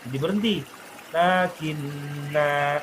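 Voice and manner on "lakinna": very slow "Lakinna" ending on a long a; lengthened final vowel. Shown as a demonstration. Teacher shows the alif lengthening, long "Lakinna". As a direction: stretch "Lakinna" to a held stop. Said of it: "alifnya berfungsi memanjangkan".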